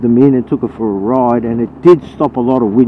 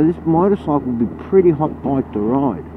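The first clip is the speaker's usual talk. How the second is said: usual speaking voice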